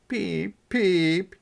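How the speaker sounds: high sad little peep; sad chick peep. A narrator's reading. Chick calling sadly to the ducklings, high peep. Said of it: "he called sadly".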